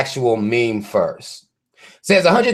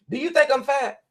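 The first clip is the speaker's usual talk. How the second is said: high, soft needy question; mock asker; 10 semitones higher, 4 dB quieter than usual. Friend asking if she looks fat, high mock voice. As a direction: fish for reassurance in a high voice.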